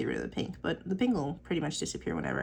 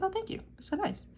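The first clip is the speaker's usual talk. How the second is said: high, sweet thanks, pitched way up; flattered thanks; 13 semitones higher, 4 dB quieter than usual. Retold or imitated. She coos her thanks when told she has nice teeth. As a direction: coo it like a touched compliment. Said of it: "I was like".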